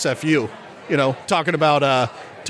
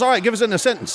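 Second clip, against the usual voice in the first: higher Australian presenter; Australian radio host; 6 semitones higher, much faster than usual. Acted. Host asking Sid for a sentence, Australian accent. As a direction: prompt the contestant in an Australian accent. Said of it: "this Australian radio station's holding a contest".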